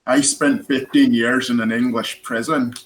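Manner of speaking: put-on film quote in an Irish accent; Gerry Conlon. Quoting the film's Irish prisoner to the panel, Irish accent. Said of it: "Daniel Day-Lewis with an Irish accent".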